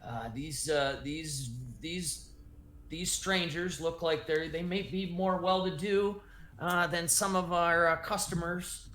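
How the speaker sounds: slow, hesitant, lightly voiced older woman; measured homeowner, probing cautiously; older woman, calmer. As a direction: stall politely while sizing up strangers. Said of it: "the older woman says".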